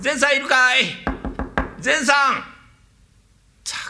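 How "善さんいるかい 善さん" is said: raised calling voice at the door. Acted. Young master calling out to Zen at his door. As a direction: call out for Zen-san.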